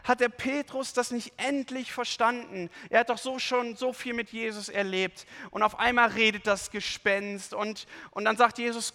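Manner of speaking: usual speaking voice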